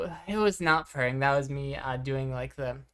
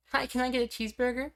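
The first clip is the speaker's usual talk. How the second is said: much higher and fast cheery order voice; drive through ordering voice; 11 semitones higher, faster than usual. Shown as a demonstration. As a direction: chirp it high and quick like ordering at a drive through. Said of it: "you drive through ordering voice is my most fun".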